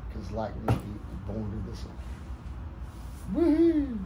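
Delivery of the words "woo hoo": high loud yeehaw whoop; cowboy whoop. Real, not acted. Cheer ending poem recital.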